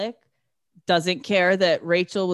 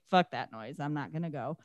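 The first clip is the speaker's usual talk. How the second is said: low, soft brush-off; mock shrug of a refusal; lower, softer dismissive quote; dismissive mutter; 7 semitones lower, 10 dB quieter, faster than usual. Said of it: "Zoe's like".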